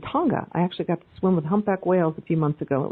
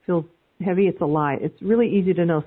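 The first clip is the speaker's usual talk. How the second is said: usual speaking voice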